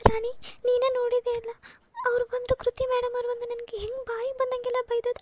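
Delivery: tearful, pitch climbing as she breaks down; weeping out her hurt to her sister; elder sister sobs to Rani about being rejected everywhere; crying. Acted. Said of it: "ಇಲ್ಲ ಅಕ್ಕ".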